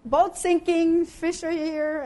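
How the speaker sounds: loud, high and slow call for help; desperate call. Retold or imitated. Fisher in sinking boat calls shore crowd, loud slow plea. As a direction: drag it out, calling across the water.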